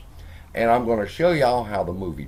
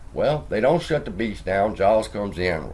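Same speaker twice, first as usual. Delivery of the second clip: ordinary delivery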